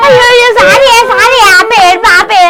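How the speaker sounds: high, urgent placating; flustered apology. Acted. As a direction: rush out sorry to stop the bawling. Panicked apology to stop the wailing. (కంగారుగా బతిమాలడం)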